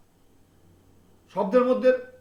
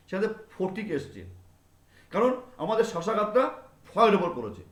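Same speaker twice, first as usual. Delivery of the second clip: normal talking voice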